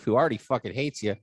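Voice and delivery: usual speaking voice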